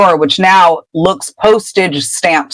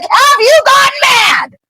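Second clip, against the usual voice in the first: very high-pitched incredulous scream; hammed-up hysterical shouting; losing it. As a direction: yell frantically, drawing the words out. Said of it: "Ron loses it again".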